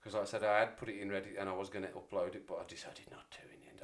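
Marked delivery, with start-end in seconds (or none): 2.5-3.8 whisper: but I decided not to in the end; whispered aside. Real, not acted. Streamer telling chat about the upload, whispered aside. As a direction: drop to a whisper mid-story.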